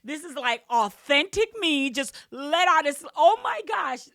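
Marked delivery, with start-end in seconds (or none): 3.1-4.1 much higher and louder, bursting with excitement; breathless delighted squeal breaking into a laugh; thrilled squeal. Real, not acted. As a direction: gush like you can't contain it. Excited retelling of her scream.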